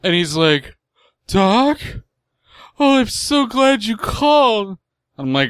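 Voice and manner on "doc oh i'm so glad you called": crying voice. Retold or imitated. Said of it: "I'm trying to do crying voice".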